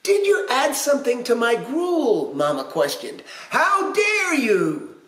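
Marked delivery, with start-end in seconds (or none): 0.0-2.3 high, insistent mama voice; high storybook accusation; questioning pirate mama. A narrator's reading. Mama interrogating the intruder. 3.5-5.0 slow, high, drawn-out outrage; high storybook affront; outraged. A narrator's reading. Mama voiced confronting the intruder.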